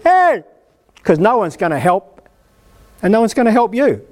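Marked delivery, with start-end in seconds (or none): 0.0-0.4 a half-laughing shout to someone far off; crying out. Retold or imitated. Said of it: "is crying out".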